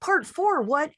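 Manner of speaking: very high, disbelieving squeak; can't believe what she just heard; stunned surprise. Retold or imitated. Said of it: "And I'm like".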